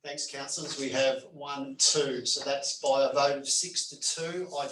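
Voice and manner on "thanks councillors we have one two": ordinary talk, nothing marked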